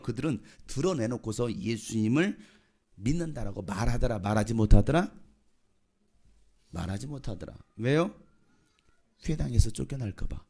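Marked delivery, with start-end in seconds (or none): 4.2-5.1 loud, low questioning repeat; punchy and loud, lifting at the end; loud emphasis. Real, not acted. Repeats the phrase loud, then hushed. 6.7-7.6 soft, low, falling echo; low and hushed, settling at the end; subdued repeat. Real, not acted. Same phrase repeated, dropped down.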